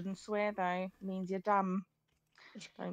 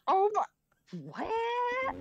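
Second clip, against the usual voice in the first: surprised; 14 semitones higher, 4 dB louder, much slower than usual. Real, not acted. Shocked exclamation.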